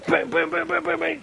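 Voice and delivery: high gleeful yell of bang noises; mock battle cry. Gamer voicing the noises in his head, gleeful high bang yells. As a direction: cheer yourself on with gun noises. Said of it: "I'm there going".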